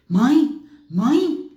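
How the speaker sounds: loud, questioning call, rising on the second. Retold or imitated. Daughter coming downstairs looking for her mother, calling out. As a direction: call for mom through the house.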